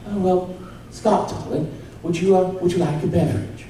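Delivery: a hint of a posh English mum offering a drink; British old lady. Mum offering Scott a drink, softly, in a light posh British accent. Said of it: "little British old lady".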